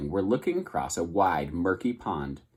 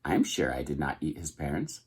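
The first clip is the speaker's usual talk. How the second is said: normal robot voice; 6 semitones lower, 7 dB quieter than usual. A narrator's reading. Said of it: "returning to her normal voice".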